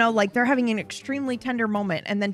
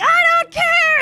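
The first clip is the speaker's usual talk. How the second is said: very high, louder melodramatic outburst; hammed-up defiant yell; mock defiant cry; 6 dB louder, slower than usual. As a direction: shout it like a doomed hero. Brother defiant before opening fire.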